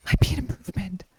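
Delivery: unvoiced, sly aside; conspiratorial whisper. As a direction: whisper the jab behind your hand.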